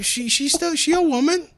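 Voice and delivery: very high incredulous squeal; mock protest. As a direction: argue back in a comically high voice. Argues back against co-host's objection.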